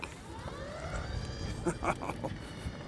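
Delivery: long, quiet, airy laugh; delighted laugh. Real, not acted. Reviewer riding off on medium, soft delighted laugh. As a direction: laugh softly as it takes off.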